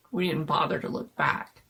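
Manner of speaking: ordinary delivery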